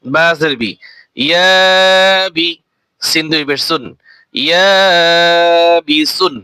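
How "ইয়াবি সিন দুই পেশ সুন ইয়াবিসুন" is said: very slow, long stretched vowel; slow Quranic spelling blend; drawn-out blend. Shown as a demonstration. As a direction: stretch the alif one beat. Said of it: "এক আলিফ টান দিয়ে".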